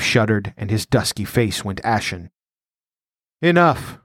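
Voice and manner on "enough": higher, curt command; peremptory. Acted. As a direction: shut the talk down with one word. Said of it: "Ascalante changed his tone peremptorily".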